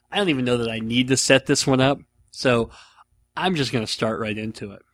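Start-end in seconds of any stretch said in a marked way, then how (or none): none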